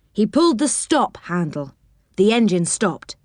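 usual speaking voice

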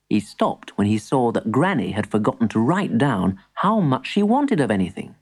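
ordinary delivery

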